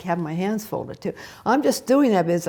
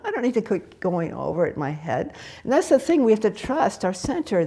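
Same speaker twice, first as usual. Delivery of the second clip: ordinary delivery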